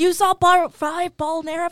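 high, loud, drawn-out retold question; excited mock question. Retelling a friend's spoiler, excited imitation.

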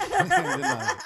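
high, loud laughing delivery. Real, not acted. Host amused by the Canadian's refusal, laughing. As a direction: crack up while repeating the punchline.

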